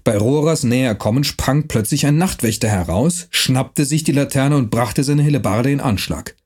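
ordinary delivery, nothing marked

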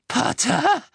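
very high, loud shriek of a question. A narrator's reading. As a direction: screech the name in disbelief. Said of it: "kreischte Bellatrix". (schrill kreischend)

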